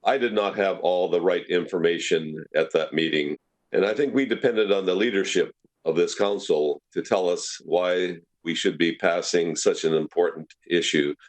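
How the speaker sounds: usual speaking voice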